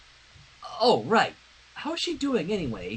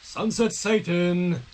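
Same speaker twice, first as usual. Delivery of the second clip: ominous nickname; much slower than usual. Pretend. Mocking villain nickname.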